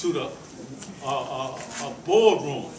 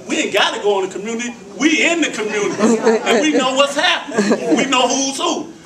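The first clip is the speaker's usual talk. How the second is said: louder and higher, building with laughter; community speech building to a fervent preach; rising preach; 5 semitones higher, 6 dB louder than usual. Real, not acted.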